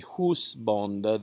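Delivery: "husbonde" as "hoos-bon-duh"; Middle English OU. Shown as a demonstration. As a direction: sound "husbonde" with oo and a final e. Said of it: "the O U is the os".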